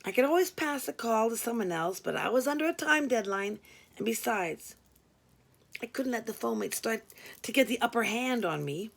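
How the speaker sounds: usual speaking voice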